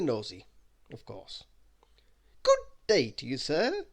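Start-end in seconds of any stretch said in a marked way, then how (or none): 2.4-3.9 oily, fawning greeting; butter up the guest; smarmy. Acted. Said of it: "smiled Mister Arthur Loaf smarmily".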